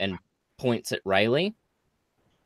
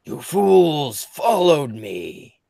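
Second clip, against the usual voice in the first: slow, louder, higher villain threat; booming echoing villain monologue; 6 semitones higher, 8 dB louder, slower than usual. Acted. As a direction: sneer it down at the fools. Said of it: "and says".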